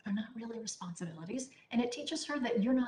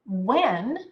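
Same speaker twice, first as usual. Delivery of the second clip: "When" punched loud and held on its own; stress on When; 6 dB louder than usual. Shown as a demonstration. Parent laying out the homework rule, "When" heavily stressed. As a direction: hit "When" hard, then leave a long pause. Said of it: "really emphasize the words when and then".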